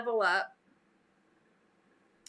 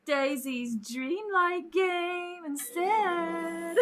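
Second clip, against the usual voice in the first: slow, drawn-out higher tease; teasing; 4 semitones higher, much slower than usual. Pretend. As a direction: stretch each word out to needle a friend. Teases Peter about another game.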